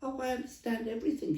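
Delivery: lightly voiced East End London accent, quick and high. The Queen answering the stallholder in East End London accent. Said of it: "she started talking the East End of London accent herself then".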